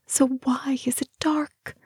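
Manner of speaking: high-pitched, fearful question to herself; frightened questioning. A narrator's reading. Terrified inner voice in darkness.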